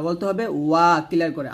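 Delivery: ordinary delivery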